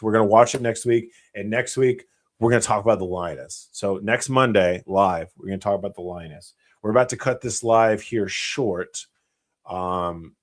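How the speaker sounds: ordinary talk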